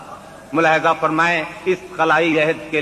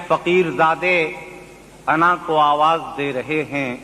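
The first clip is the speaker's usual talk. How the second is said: poetry recitation; 5 semitones higher than usual. Acted. Mushaira recitation.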